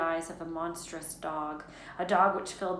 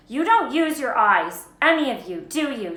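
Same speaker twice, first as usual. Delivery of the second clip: loud, higher, exasperated scolding; bad-tempered, emphatic rebuke; snapping; 6 semitones higher, 12 dB louder than usual. A narrator's reading. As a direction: snap at them for being unobservant. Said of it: "she snapped".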